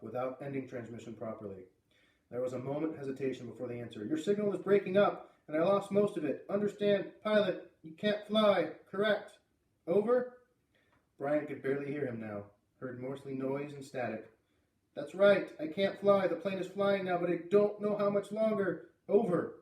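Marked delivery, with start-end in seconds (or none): 4.0-10.3 high, loud, measured checking over radio; radio operator. A narrator's reading. Operator on a breaking signal checking caller can't fly, raised voice. 14.9-19.5 high, loud, anxious radio reply; urgent alarm. Acted. Boy confirming over failing radio he can't fly, alarmed.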